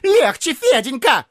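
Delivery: high tearful pleading; plaintive voice. A narrator's reading. Neighbour begging Fedenka to go easier, tearful. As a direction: call out high and whiny. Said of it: "плачущим голосом".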